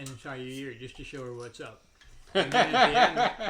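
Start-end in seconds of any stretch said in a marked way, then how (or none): 2.3-3.5 loud, slow, rising drawn-out setup; drawn out, louder, higher, building suspense; drawn-out buildup. Real, not acted. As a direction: stretch it out for the big reveal. Dramatic build to punchline.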